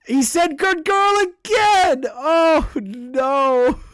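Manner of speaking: high, loud wailing shout; appalled outburst. Real, not acted. Streamer shouting that he said it again, appalled. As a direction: wail it in appalled disbelief.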